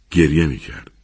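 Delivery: plain reading voice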